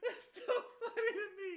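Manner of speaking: words squeezed out high through laughter; real laughter breaking the words; laughing. Real, not acted. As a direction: talk while still cracking up. Still laughing after long laugh.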